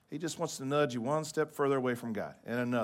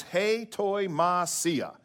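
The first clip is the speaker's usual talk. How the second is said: slow, higher "hei toi masia", each part stretched; real slow; 6 semitones higher, 4 dB louder, much slower than usual. Shown as a demonstration. Preacher teaching a fun Greek word, real slow. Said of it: "I'll say it real slow".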